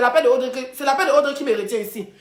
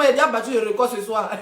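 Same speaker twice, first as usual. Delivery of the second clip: ordinary delivery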